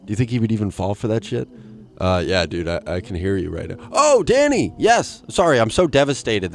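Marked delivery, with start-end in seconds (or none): 3.9-6.5 high, loud put-on exclamation; mock eager reply. Host pretending to answer Danny on the phone, over-eager. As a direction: burst back into the fake call.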